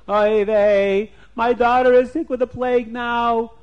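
distressed king. Acted. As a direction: cry out like a panicked king. King cries out over daughter.

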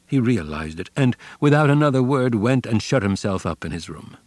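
ordinary delivery, nothing marked

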